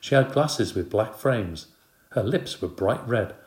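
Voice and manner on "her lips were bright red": usual speaking voice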